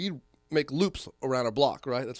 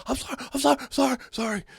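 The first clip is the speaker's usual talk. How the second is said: much higher, pleading sorry-sorry; panicked apology; 12 semitones higher, 5 dB louder, faster than usual. Retold or imitated. Scared bullies begging him for mercy, high panicky pleading. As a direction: beg fast like a scared kid.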